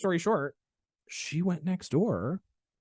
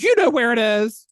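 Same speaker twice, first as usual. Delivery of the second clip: high, loud, impatient caller; exasperated shout; 8 semitones higher, 10 dB louder than usual. Retold or imitated. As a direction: shout the obvious, hammed-up exasperation. Retold patient exasperated at guesses.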